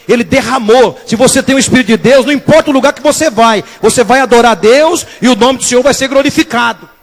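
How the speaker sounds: loud, fervent pulpit proclamation; impassioned, high-energy sermon delivery; full-force preaching. Acted. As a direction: preach it with mounting intensity to a warning cry.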